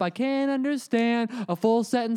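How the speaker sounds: high, slow, drawn-out exasperation; exasperated mock complaint. To the stranger pounding on the glass, slow high exasperated whine. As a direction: throw up your hands and whine it out.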